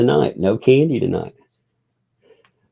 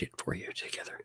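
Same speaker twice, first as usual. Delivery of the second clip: whisper; 25 dB quieter, much faster than usual. Real, not acted. Whispering before reading aloud.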